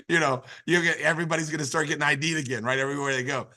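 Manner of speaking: ordinary delivery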